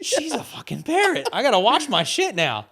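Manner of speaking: high-pitched, incredulous outburst; amused, exaggerated alarm; mock exasperation. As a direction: exclaim it way up high in comic disbelief. Exasperated repeat of his reaction.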